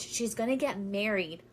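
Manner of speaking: normal talking voice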